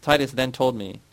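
ordinary delivery

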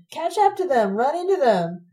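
high, loud egging on; reckless shout. A narrator's reading. Sabina urges Ron to ram the boys, loud high shout. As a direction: scream it, thrilled.

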